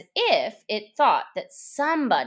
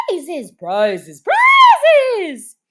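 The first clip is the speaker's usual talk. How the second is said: building to a much higher, loud shout; whole party shouting for prizes, much higher; calling out; 10 semitones higher, 12 dB louder, much slower than usual. A narrator's reading. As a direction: crowd clamouring louder and louder. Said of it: "calling out in a confused way".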